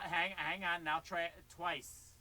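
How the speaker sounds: normal talking voice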